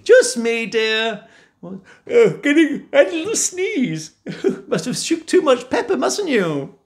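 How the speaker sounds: high, giggly fake innocence; cover-up. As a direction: laugh it off to cover Jack. Wife fakes innocence.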